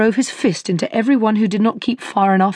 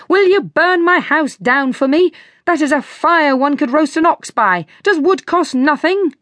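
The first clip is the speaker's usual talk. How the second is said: angry crying out; 7 semitones higher than usual. A narrator's reading. Grumpy shoemaker yells at his wife over the fire, indignant. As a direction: storm into the kitchen scolding.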